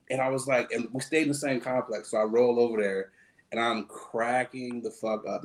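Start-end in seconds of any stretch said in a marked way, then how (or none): none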